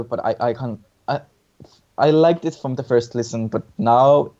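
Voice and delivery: ordinary delivery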